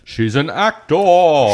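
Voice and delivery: drawn-out, loud theatrical "actor"; mock grand. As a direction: ham up the word like a stage luminary. Mock dramatic emphasis on actor.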